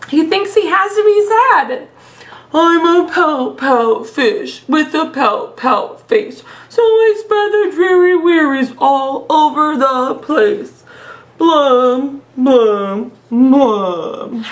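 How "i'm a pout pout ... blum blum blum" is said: slow, drawn-out mopey fish voice; glum pout fish voice. Acted. Teacher reads the sulky fish refrain, slow and mopey. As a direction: drag each word out like a gloomy sulking fish. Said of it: "I love doing the silly voices".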